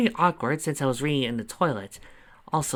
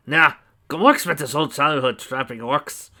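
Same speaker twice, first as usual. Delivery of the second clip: gnome character voice; 2 semitones higher, 6 dB louder than usual. Acted. Gamork's voice in the scene.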